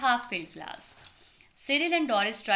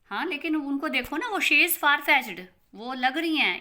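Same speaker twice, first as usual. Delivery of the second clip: she is far-fetched much louder, she stressed; emphatic Doris; 5 semitones higher, 5 dB louder than usual. Acted. Doris insists to Cyril mother is odd, loudly. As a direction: push "she is far-fetched" out loud and high, she hit hard.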